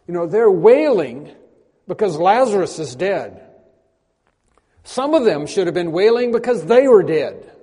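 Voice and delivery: loud, rising sermon delivery; fervent preaching. Acted. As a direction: preach it hard, voice swelling with emotion. Preacher building to full force.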